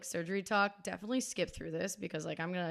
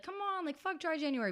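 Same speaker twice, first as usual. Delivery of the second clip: tempting friends; 8 semitones higher than usual. Retold or imitated. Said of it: "trying to tempt me and be like".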